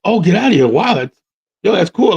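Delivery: impressed retold reaction. Man impressed by his date's ventriloquism, retold excitedly. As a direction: react as if wowed by a cool hobby.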